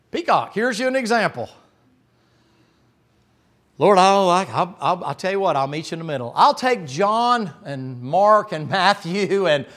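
loud, high, stammering bargainer; haggling disciple. Pretend. Preacher plays a disciple haggling with Jesus over feet, loud comic bargaining. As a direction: cut a deal with the Lord, flustered.

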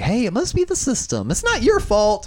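high, sugary mock politeness; sweet on top, annoyed underneath; forced understanding. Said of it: "be very understanding and just be like".

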